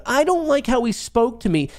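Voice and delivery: high, whiny crybaby imitation; mocking whine. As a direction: sneer at the complainer by mimicking him. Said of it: "you're such a fucking pussy that you're".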